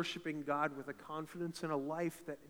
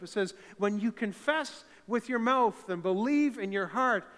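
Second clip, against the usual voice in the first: loud, higher proclaiming; full-force preaching; 5 semitones higher, 8 dB louder than usual. Real, not acted. Pastor proclaiming salvation to the church, loud and high. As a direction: proclaim the scripture with fire.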